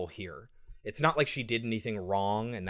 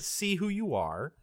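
ordinary talk

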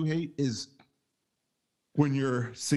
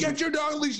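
high-pitched mock yell; retold scream, pitched way up; imitated screaming; 12 semitones higher than usual. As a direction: imitate a man yelling at a dog owner. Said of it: "he's just screaming".

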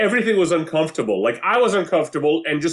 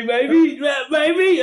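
mock hopeful maybe; 8 semitones higher, much slower than usual. Guy asking the woman he rejected for a date, mock hopeful. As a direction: whine the maybe like a hopeful loser. Voicing the guy's hopeful ask.

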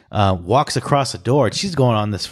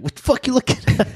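high, fast put-on voice of a stranger; mock defiant retort; 12 semitones higher, faster than usual. Friend mimics a woman who just fell, high and fast. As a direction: snap back like someone caught falling.

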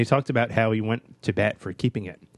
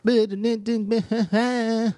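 laugh; 11 semitones higher than usual. Real, not acted.

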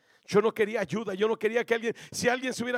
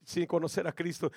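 normal talking voice

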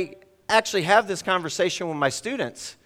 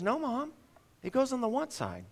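put-on higher and softer, a child correcting mom; kid's reply; 8 semitones higher, 6 dB quieter than usual. Son telling his mother where shoes go, high and soft. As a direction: answer like a sure little boy.

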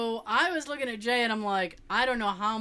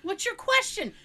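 higher-pitched mock exasperation; impatient crowd; 9 semitones higher than usual. Audience fed up with rambling questioner, mock impatient plea. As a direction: imitate an audience urging him along. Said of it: "everybody's kinda sitting there like".

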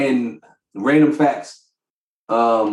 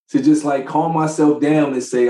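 usual speaking voice

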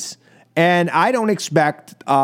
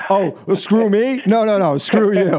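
mock Priebus; 2 semitones higher than usual. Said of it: "Priebus is like".

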